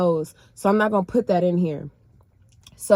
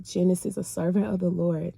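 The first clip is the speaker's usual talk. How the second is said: voice of the Lord; 5 dB quieter than usual. Retold or imitated. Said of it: "the Lord was revealing to me".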